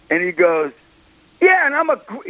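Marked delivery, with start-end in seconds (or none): 1.4-2.3 high-pitched retold emotional outburst; moved man, imitated in a high voice; imitated tearing up. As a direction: quote him high, as if moved to tears. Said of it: "he's, like, tearing up".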